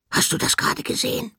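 excited hushed squeal. Acted. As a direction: blurt it out, can't believe what you saw. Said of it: "fragte Horn plötzlich ganz aufgeregt".

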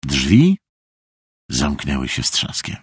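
ordinary delivery, nothing marked

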